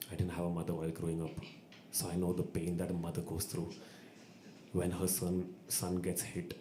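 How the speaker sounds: low, soft mock-solemn voice; baritone confession. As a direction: confide it in an imitated deep, hushed voice. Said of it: "he gets his baritone voice out".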